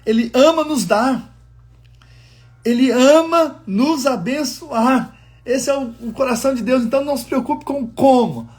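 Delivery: preaching. Acted. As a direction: lift it up and proclaim God's love. Preaching climax. (pregação fervorosa e elevada)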